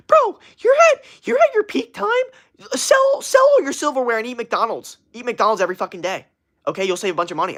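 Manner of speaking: high, loud, pushy mock hustler voice; Gary Vee impression. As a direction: start way up high and loud, easing down gradually. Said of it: "'cause that's what he sounds like".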